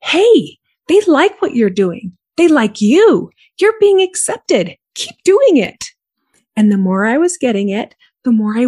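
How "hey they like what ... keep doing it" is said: higher, louder eager inner voice. Retold or imitated. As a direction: egg yourself on like an inner cheerleader. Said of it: "my subconscious thinking went something like".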